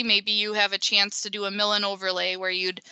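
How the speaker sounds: ordinary delivery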